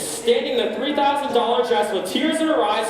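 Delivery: ordinary talk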